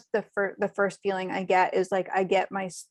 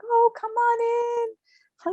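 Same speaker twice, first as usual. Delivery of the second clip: much higher, bright sing-song welcome; sweet mother welcoming. Retold or imitated. Mom spirit welcoming daughter's friends, high sweet gushing. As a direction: gush like a delighted hostess at the door. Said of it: "She's like".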